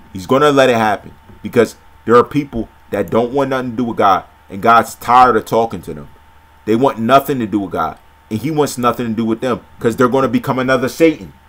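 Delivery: ordinary talk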